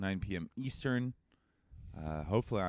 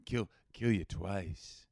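slow, drawn-out repeat of the threat; slow mock echo; slower than usual. Host echoes his partner's mock threat, slowly. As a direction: savour each word of the threat.

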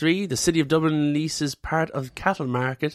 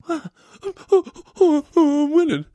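much higher, louder whine; mock whiny protest; 12 semitones higher, 5 dB louder than usual. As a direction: whine that you were winning.

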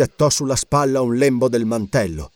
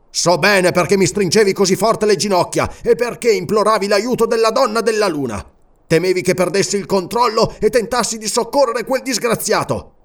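furious tirade; 7 semitones higher than usual. A narrator's reading. King to servant, raging over the crucified man. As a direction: vent his fury at Rome. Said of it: "in preda al furore".

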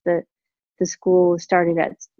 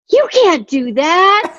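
high, loud, drawn-out mock outrage; so mad; 11 semitones higher, 5 dB louder, slower than usual. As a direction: imitate an outraged teen daughter protesting.

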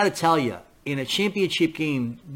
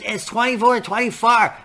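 excited shout; 9 semitones higher, 6 dB louder than usual. Retold or imitated. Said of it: "She goes".

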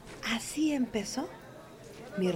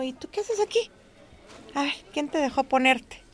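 haughty mock challenge; 6 semitones higher, 5 dB louder than usual. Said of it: "diciéndome como".

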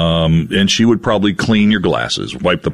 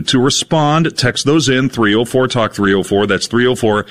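ordinary talk

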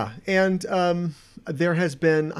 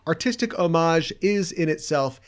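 measured, formal read-aloud intro; podcast script read; 3 semitones higher, 3 dB louder than usual. Acted. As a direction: read the segment intro like a documentary.

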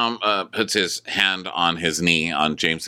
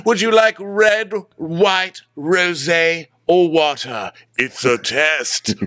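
higher and louder grand hostess voice; higher, louder sly hostess; mock grand hostess; 11 semitones higher, 5 dB louder, slower than usual. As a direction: offer drinks like a sly test. Voicing Lisa hosting.

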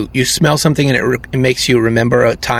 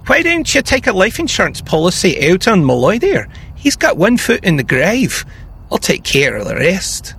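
higher, lilting mock Irish; attempted Irish accent; 4 semitones higher than usual. Shown as a demonstration. Co-host showing off an Irish take on Frank's murder pitch, attempted Irish accent. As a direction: put on a playful Irish brogue with Scottish leanings. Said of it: "an Irish accent on the why don't".

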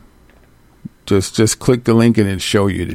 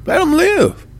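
high exasperated plea. Real, not acted. Exasperated plea about haters.

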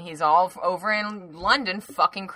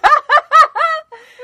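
high loud burst of laughter; 12 dB louder than usual. Real, not acted. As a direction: let out a big cackle.

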